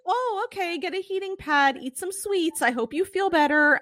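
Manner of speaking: imitating the idol soothing a fan gently; gentle idol voice. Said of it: "who's like".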